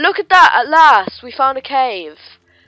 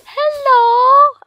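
much higher, louder sing-song hello; squeaky playful hello; silly greeting; 13 dB louder than usual. Pretend. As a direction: greet the slime like a cute pet. Greeting a purple slime.

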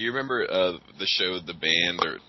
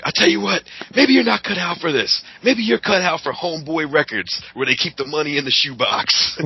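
much higher, loud [name] voice; [name] impression; 8 semitones higher, 4 dB louder than usual. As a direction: do [name] lecturing the kids. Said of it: "Puff was like".

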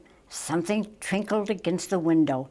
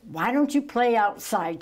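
ordinary delivery, nothing marked

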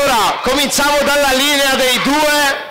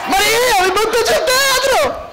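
rising alarmed cry; 12 semitones higher than usual. Real, not acted. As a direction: pitch climbing higher and higher. Ball nearly wrecks theatre. (grido allarmato crescente)

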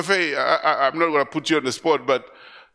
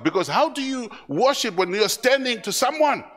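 usual speaking voice

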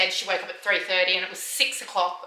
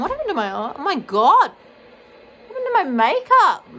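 higher, louder shocked exclamation; surprised dismay; 5 semitones higher, 5 dB louder than usual. Real, not acted. Mother spotting her smudged makeup, shocked and loud. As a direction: gasp at the mess in the mirror.

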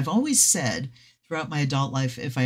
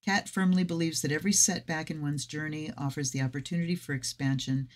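ordinary delivery

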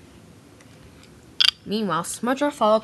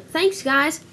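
forced cheer; 7 semitones higher, 4 dB louder than usual. Acted. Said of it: "tried to sound cheerful".

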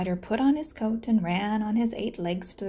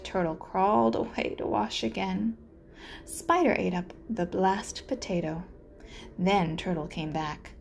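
usual speaking voice

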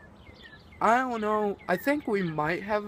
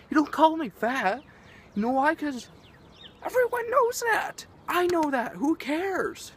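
higher and louder, fed up; exasperated outburst; 9 semitones higher, 3 dB louder than usual. Real, not acted. Ranter to people calling him fat, exasperated higher outburst. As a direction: throw it back at them, exasperated.

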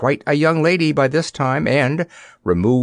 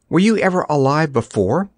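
higher-pitched curious question; 5 semitones higher than usual. A narrator's reading. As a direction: ask with open curiosity. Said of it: "asked Dorothy curiously".

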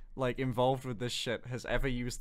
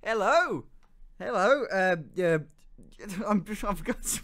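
hyper posh accent; 5 semitones higher than usual. Shown as a demonstration. Showing Americans' idea of Brits, badly faked posh accent. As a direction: attempt a hyper posh accent, then falter. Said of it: "hyper posh accent".

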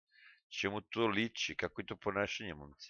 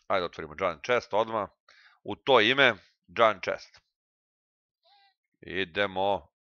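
ordinary delivery

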